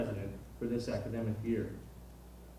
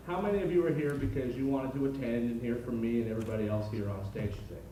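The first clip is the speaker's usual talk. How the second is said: ordinary delivery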